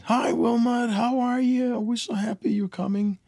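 very high sing-out imitation of a host mom; mock high-pitched welcoming woman's voice; gushing host mom. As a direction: greet him as an overjoyed host mom, high and loud. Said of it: "Her host mom came on the phone".

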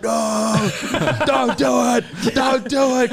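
high, slow pleading no don't do it; imitating his brother. Brother on the phone begging him not to fight, high. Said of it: "he's like".

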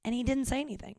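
ordinary talk

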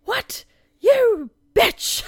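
high, loud shocked outburst; imitated outraged shout. Retelling a sitcom character hearing his tax bill, imitated high shout. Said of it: "and he shouts".

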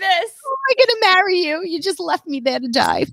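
hammed-up wounded fiancée; Vince Vaughn. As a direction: protest in mock disbelief. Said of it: "I'm, like, Vince Vaughning him".

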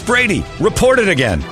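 ordinary delivery

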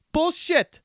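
high, loud outburst; angry shout. Real, not acted. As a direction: yell it out in disgust. Calling out the copycat.

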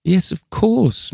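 high, slow, testy reply; peevish. A narrator's reading. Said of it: "replied rather peevishly".

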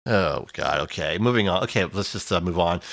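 low, deflated sigh of words; weary resignation, pitched well down; resigned. Real, not acted. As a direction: give up and move along, dropping low and soft. Deflated by a bleak release outlook.

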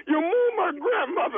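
mock sobbing through the words; fake crying. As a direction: sob it out as part of a prank. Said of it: "you started the whole prank with crying".

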